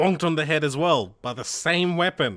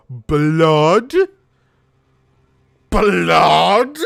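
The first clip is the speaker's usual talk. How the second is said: loud, drawn-out rising question; big, swooping cry of disbelief; alarmed shout; 2 semitones higher, 10 dB louder than usual. Acted. Acted discovery of blood, shouted.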